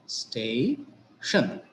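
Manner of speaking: "stay", then "shun", as two separate beats; split into syllables. Shown as a demonstration. Teacher showing two syllables, "stay", pause, "shun". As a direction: slowly break station into "stay" and "shun". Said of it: "It has two syllables".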